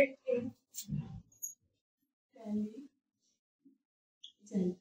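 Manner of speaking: इंटेलिजेंट very slowly and softly, long gaps between parts; drawn-out, hushed इन... टेलि... जेंट; broken into syllables. Shown as a demonstration. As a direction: murmur "इंटेलिजेंट" piece by piece, as if counting. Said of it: "काफी vowels word में देखिए".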